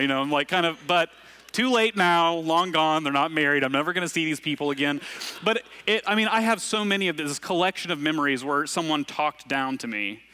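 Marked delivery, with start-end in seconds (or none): none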